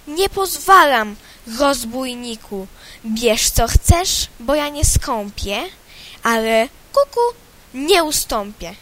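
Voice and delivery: loud, defiant scolding; angry cuckoo. Acted. Cuckoo scolding the rooster, loud and defiant. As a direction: stand your ground and yell at him. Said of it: "wrzaśnie".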